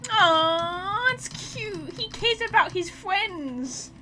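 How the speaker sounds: slow, high, doting coo; cooing fondly over a sweet friend; cooing over him. Real, not acted. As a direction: gush over the cute character like a pet. Aw, it's cute.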